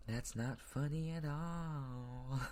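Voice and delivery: very slow, pointed words; slow repeat that cuts down the joke; slow stern repeat. Real, not acted. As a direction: drag each word out in disapproval. Repeated slowly and softly.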